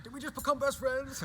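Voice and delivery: high, loud movie-quote voice; Step Brothers quote. Retold or imitated. Guy quoting Step Brothers to his new mate, high and loud. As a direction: belt it like the Step Brothers line. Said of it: "have you seen Step Brothers when it's like".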